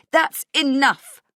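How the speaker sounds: loud, high, drawn-out shout; desperate, angry shout; yelling. Acted. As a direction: yell it to cut them off. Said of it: "I yell".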